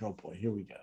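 a weary inner sigh of dread, barely voiced; dread under breath. Dreading a political argument.

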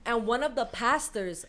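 ordinary talk

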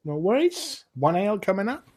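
high barmaid voice; cheerful barmaid. Acted. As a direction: serve them cheerily. Innkeeper takes orders.

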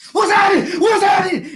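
high, loud raging repeat; furious shout. Retold or imitated. Kevin to the kid who kicked his bag, raging shout. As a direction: lose it completely. Kevin losing it over backpack.

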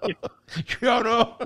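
aguda, negando entre risas; imitando a la señora. Retold or imitated. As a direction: yo no, yo no, asustada. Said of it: "y decía". (imitación de señora asustada entre risas)

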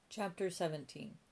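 ordinary delivery

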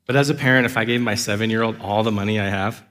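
usual speaking voice

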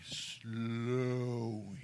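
SLOWING in slow motion, the first syllable held; drawn-out slowing. Shown as a demonstration. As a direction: stretch "Slowing" out long and soft. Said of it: "Say it with me".

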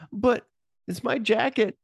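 very high-pitched put-on objection; squeaky acted complaint; mock whiny protest. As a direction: imitate a helpless plea, voice way up. Acted quarrel, weaker side protests.

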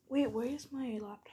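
usual speaking voice

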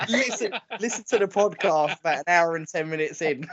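talking through laughter; barely holding in the giggles while speaking; laughing. Real, not acted. Cracking up over the message for Callum, laughing.

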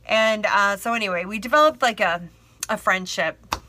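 ordinary delivery

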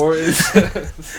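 slow, loud, laughing through the words. Real, not acted. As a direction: drag it out while cracking up. Echoes the line while laughing.

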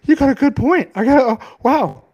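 high mock-impressed gushing; sarcastic fake agreement. Mocking a listener's opinion, high fake awe. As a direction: pretend to be won over, dripping sarcasm. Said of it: "I'm gonna, like".

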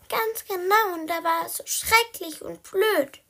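high, emphatic kitten squeak; high-pitched complaining squeak; squeaky peep. Acted. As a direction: pipe up high in eager agreement. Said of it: "piepste Blackie".

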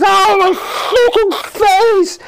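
very high, loud mock scream; agonised scream. Man with acid spat in his face, hammed-up screaming. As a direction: shriek it out in hammed-up pain.

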